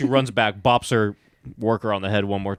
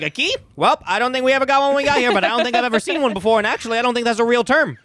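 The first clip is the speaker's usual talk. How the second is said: much higher, fast, louder rambling; ditzy high voice; 11 semitones higher, 4 dB louder, faster than usual. Retold or imitated. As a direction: play clueless, high and rapid. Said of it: "She's like".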